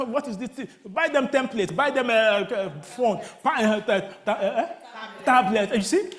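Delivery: ordinary delivery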